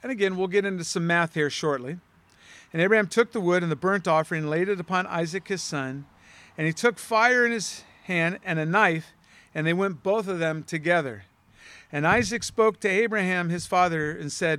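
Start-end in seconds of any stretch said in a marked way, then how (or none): none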